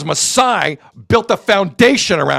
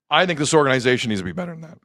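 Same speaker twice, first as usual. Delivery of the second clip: ordinary talk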